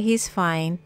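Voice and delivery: ordinary talk, nothing marked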